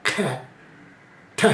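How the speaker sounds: ठ pushed out with a burst of breath; forceful ttha. Shown as a demonstration. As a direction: spit out ठ hard. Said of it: "ट को बोला तो".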